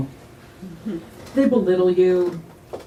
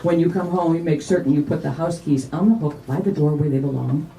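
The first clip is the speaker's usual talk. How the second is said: ordinary delivery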